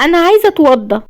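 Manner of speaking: high-pitched fragile plea; frail, upset young woman's request; weakly. A narrator's reading. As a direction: ask it high, as if drained. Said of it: "قالتها بضعف".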